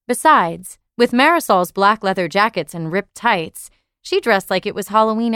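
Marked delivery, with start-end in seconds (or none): none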